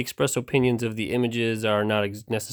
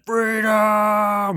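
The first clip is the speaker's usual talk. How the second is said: high, loud freedom cry; William Wallace battle cry; 9 semitones higher, 3 dB louder than usual. Retold or imitated. Tugboat shouting like William Wallace to escape. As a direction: yell it like Braveheart. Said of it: "in the words of the great William Wallace".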